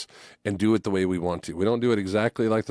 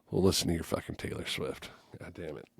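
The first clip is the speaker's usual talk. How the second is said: grumbled mutter; 4 semitones lower, 9 dB quieter than usual. Retold or imitated. Grumbling reply to wife.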